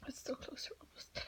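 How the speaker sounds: hushed, anxious whisper to herself. Acted. Student at her desk whispering pep talk to herself, anxious whisper. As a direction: keep it breathy and secret, talking yourself through it.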